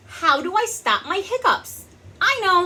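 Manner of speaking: high, loud storybook voices; character voices. Acted. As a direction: ask and answer like the animals. Voicing characters.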